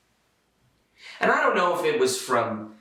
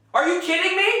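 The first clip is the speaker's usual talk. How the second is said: exasperated; 4 dB louder, faster than usual. Retold or imitated. Exasperated outburst.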